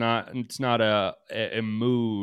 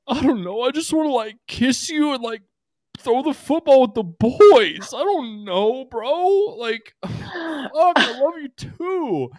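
mock crying high voice; 5 dB louder than usual. Aaron sobbing to her after their fight, hammed-up high whine. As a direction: squeak it out like a weepy jock near tears. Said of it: "and he says in tears".

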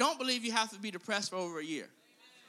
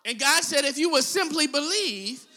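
loud preaching of God's word; 4 semitones higher, 10 dB louder than usual. Acted. Preacher declaring God's answer, loud. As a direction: proclaim it loudly.

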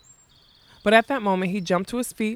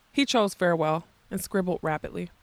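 ordinary delivery

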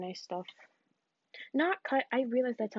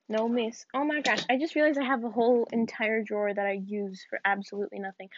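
ordinary talk

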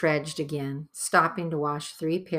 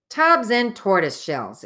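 higher, louder gruff dwarf exclamation; exclaiming; 6 semitones higher, 8 dB louder than usual. A narrator's reading. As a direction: blurt out the oath in dismay. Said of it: "exclaimed Trumpkin".

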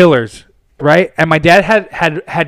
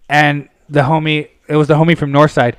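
normal talking voice

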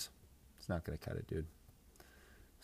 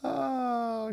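drawn-out high oh; 4 dB louder than usual. Real, not acted. Drawn sigh after laughing at own bit.

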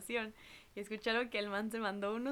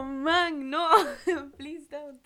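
laughing protest; 4 semitones higher, 9 dB louder, much slower than usual. Retold or imitated. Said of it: "y yo como".

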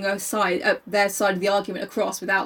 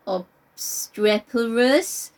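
drawn-out word; much slower than usual. Retold or imitated.

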